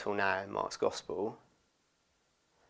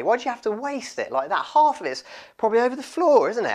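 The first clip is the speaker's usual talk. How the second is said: high, loud, acted objection; exasperated complaint; 12 semitones higher, 6 dB louder, faster than usual. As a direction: protest as an exasperated dinner guest. Voicing disciples complaining at the woman.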